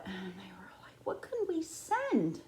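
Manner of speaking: soft whisper, wondering aloud; whispered musing. Pretend. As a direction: whisper the zoo's secret question. Zoo voiced pondering.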